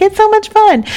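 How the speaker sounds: very high-pitched squeal of delight; high, giddy mock enthusiasm; mock gushing. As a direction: squeal it, imitating an over-excited party fan. Mimicking future gushing about the party.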